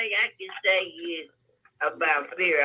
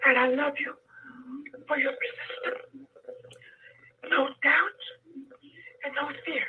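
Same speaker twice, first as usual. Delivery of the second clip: hoarse, breaking voice fading to a whisper; weak voice that drops to a whisper; voice going in and out; 7 semitones higher than usual. Real, not acted. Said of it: "you have to excuse my voice".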